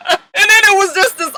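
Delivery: normal talking voice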